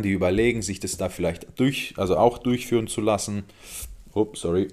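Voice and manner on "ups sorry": about to burp; holding back a burp. Real, not acted. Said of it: "Muss rülpsen".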